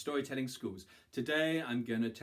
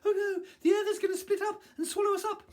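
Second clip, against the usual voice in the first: very high, fast, loud shriek; panicked screaming; 9 dB louder, faster than usual. A narrator's reading. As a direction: scream the warning on the run. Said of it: "screaming out".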